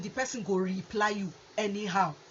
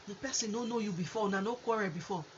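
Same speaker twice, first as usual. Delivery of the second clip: ordinary delivery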